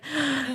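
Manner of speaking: sharp gasp of shock. Retold or imitated. Girl realizes the pizza is fish, gasps.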